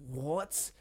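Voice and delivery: ordinary talk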